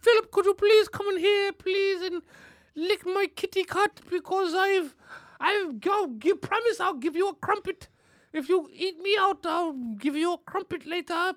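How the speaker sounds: high, wheedling mock-British falsetto; Queen Elizabeth. The queen coaxing her husband, high hammed-up British voice. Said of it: "it's her accent".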